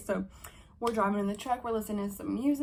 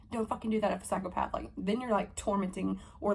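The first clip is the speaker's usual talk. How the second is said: ordinary talk, nothing marked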